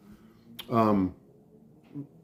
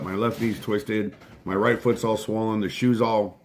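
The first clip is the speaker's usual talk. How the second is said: ordinary delivery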